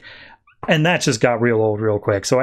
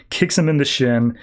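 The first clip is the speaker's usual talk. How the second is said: normal talking voice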